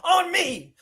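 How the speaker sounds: slow, high Denzel Training Day boast; drawn-out King Kong boast. Retold or imitated. Boasting to Tino, slow Denzel-style brag. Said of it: "I turned into Denzel".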